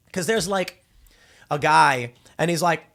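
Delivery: usual speaking voice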